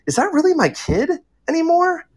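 ordinary delivery

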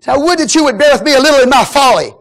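high, loud, exaggerated mock plea; sarcastic. Paul to those putting up with him, loudly sarcastic. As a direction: shout the plea, then a big mock "oh".

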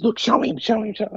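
high, eager urging of a friend; Christy urges her friend to show the tattoo, excitedly. Retold or imitated. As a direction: push him to show it, all excited. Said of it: "And Christy goes".

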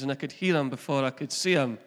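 usual speaking voice

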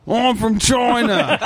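loud, high, drawn-out fake Chinese accent; mock Chinese accent. Guest answers host's request for an accent, absurd Chinese accent. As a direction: badly fake a Chinese accent at full volume. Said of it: "Chinese accent. Can you do that?".

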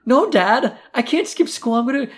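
very high, loud imitation of a frantic kid; mock panicked protest. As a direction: plead with Dad in exaggerated alarm. Retold panic at skipping school.

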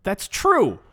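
exasperated third repeat, loud; loud realization. Acted. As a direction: hit it hard as it dawns on you. Repeating, building to outburst.

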